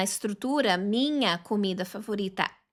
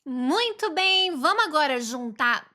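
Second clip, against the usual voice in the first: upbeat teacher; 5 semitones higher, 4 dB louder than usual. Real, not acted. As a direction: cheer the class on. Teacher wraps up loudly. (professora animada elogiando a turma)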